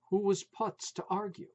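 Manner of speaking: plain reading voice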